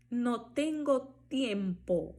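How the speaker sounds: slow NO TENGO TIEMPO, clean T in tiempo; hard T. Shown as a demonstration. Teacher pointing out the difference, hard T in tiempo. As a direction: model tiempo without any "ch". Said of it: "vejam a diferença aí".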